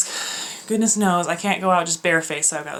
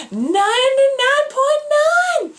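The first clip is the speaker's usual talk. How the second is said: much higher, slow, sing-song; mock cheerful answer; 5 dB louder, much slower than usual. [name] mocking what to answer the instructor, sing-song. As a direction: drag it out, over-eager.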